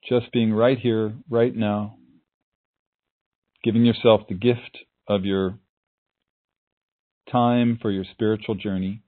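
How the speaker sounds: calm, unhurried meditation voice; guided meditation. Acted.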